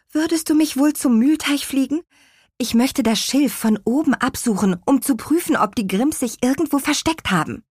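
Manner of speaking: high-pitched little witch voice; pleading little witch. A narrator's reading. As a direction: ask the favour in a high, eager voice. Witch asks beetle for a ride.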